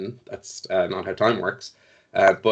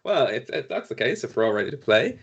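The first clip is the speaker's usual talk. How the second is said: usual speaking voice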